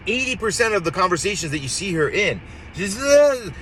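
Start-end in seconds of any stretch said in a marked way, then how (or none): 2.7-3.5 higher-pitched imitation of slurring; mock slurred speech. Mocking a candidate who slurs her speech, mock drunken slur. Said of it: "she slurs her speech".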